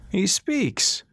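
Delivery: delighted the prisoner finally talks; old doctor. Acted. Old doctor to Ras, high and amused.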